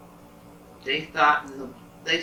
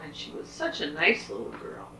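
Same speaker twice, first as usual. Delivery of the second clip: ordinary delivery, nothing marked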